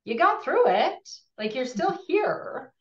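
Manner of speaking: high, lilting pep talk; acted encouragement. Reminding someone they survived their grief, high encouraging lilt.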